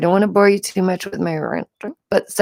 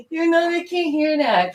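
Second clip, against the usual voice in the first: laughing; 10 semitones higher than usual. Real, not acted. Laughing at her son.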